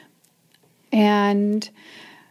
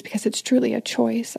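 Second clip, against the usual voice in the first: ordinary talk, nothing marked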